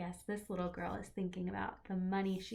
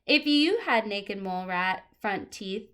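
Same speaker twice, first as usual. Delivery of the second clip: lively picture-book read-aloud; reading to children; 11 dB louder than usual. Acted. Teacher reading a book to the class, lively and clear.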